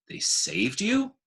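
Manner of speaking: high, slow, astonished question; he can't believe what he's hearing; a dog's wide-eyed doubt, drawn out high; amazed disbelief. Acted. Bob doubts Ruby's rescue story.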